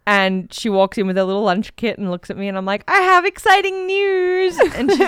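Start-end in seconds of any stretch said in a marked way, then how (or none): 2.9-4.6 high, slow, sing-song tease; teasing announcement. Retold or imitated. Teasing her wife at the door with exciting news, high and slow. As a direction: draw out the big reveal.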